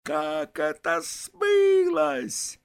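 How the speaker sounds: high, loud, drawn-out screech; shrill yell. A narrator's reading. Vaska yells back at his friend, shrilly. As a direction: screech it, stretching the last word. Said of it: "орёт он визгливо".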